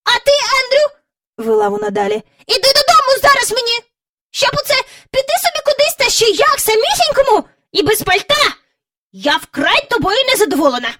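very high, loud, fast scolding; scolding lady. A narrator's reading. Miss Lark scolding Andrew, high and fast. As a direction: scold the naughty pet.

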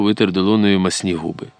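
ordinary delivery, nothing marked

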